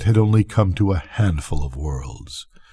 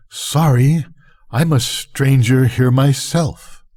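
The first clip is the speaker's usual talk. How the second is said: higher, lightly voiced machine talking; a dry aside from an ancient machine, pitched up; pyramid speaking; 6 semitones higher, slower than usual. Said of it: "the little pyramid was speaking to me and was saying".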